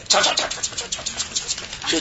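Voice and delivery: voiceless, sputtering repeated syllable; failing to get there. Retold or imitated. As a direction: imitate a chant running out of steam. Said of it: "就上不来那个劲儿". (模仿喊得没劲、只剩气声)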